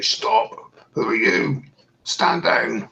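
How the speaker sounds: lightly voiced guard's command; guard's challenge. Game master voices guards halting the robed man, mildly commanding. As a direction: challenge an intruder without raising the volume. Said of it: "You hear him say".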